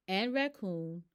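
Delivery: plain reading voice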